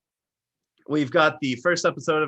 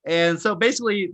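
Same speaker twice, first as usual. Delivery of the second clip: normal talking voice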